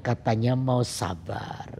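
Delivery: low, slow gentle chiding; God's reminder. Retold or imitated. God reminding the preacher as anger rises, low slow reminder. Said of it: "firman Tuhan bilang".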